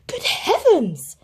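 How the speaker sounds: slow, high gasp; shocked. A narrator's reading. Aunt Sarah sees the dead rat, shocked. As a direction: draw it out in shock. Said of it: "said Aunt Sarah".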